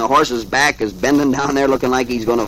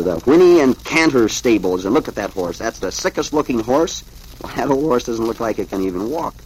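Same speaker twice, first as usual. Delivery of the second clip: usual speaking voice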